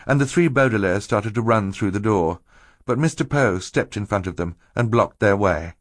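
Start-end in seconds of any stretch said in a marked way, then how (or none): none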